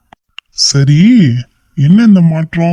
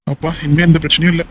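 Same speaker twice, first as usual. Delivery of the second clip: ordinary talk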